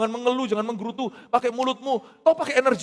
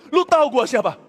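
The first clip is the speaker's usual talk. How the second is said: loud, high, indignant challenge; offended angel; 7 semitones higher, 9 dB louder than usual. Acted. Angel Gabriel scolding doubtful Zakaria, loud offended challenge. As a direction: bristle with wounded pride, loud. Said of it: "malaikat sampai begitu sudah tersinggung banget".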